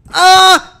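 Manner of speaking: loud high scream; startled scream. Real, not acted. Gamer hit by a jump scare, high scream. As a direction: shriek at the scare.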